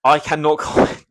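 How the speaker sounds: half-giggling no comment; laughing refusal. Real, not acted. Asked about race ratios, laughing off the answer. Dodging a touchy question, laughing.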